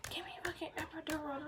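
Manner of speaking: hushed tearful plea; desperate whisper. Retold or imitated. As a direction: beg for the epidural under your breath. Crying mid-labor, begging for epidural.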